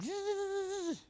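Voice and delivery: high buzzing "zzz" like a drill; quiet, high dentist-drill whine; drill noise. Retold or imitated. Preacher mimics God's finger boring into the jawbone, drill noise.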